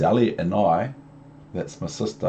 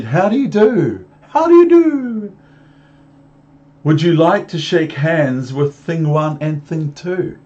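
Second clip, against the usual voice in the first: squeaky high voices of Thing One and Thing Two; playful high creature voices; Things' voices; 8 semitones higher, 8 dB louder than usual. Acted. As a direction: pitch way up, bouncy and loud. Things greet the children.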